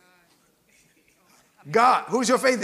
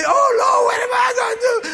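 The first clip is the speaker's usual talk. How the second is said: much higher, louder hammed-up wail; mock boo-hoo crying; 11 semitones higher, 4 dB louder than usual. Mocking a churchgoer who trusted Moses, hammed-up crying wail. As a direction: play the helpless mourner, wailing to the Lord. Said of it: "boo-hoo crying".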